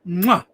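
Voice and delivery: a loud smacking kiss of approval; chef's kiss. Real, not acted. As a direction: kiss your fingertips like a chef praising food. Praising a viewer's photo.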